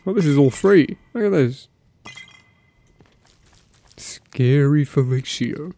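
ordinary talk

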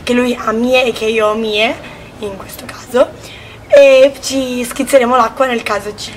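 usual speaking voice

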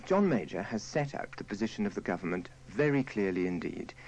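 normal talking voice

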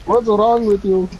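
high, slow exasperated yell; angry yelling. Acted. Passenger snaps back at the driver, yelling. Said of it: "Don't yell at me".

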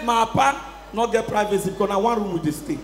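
normal talking voice

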